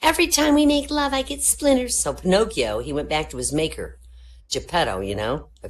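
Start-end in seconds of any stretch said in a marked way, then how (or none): 0.0-2.0 high, loud whiny wife; high nagging wife voice; wife's complaint. Acted.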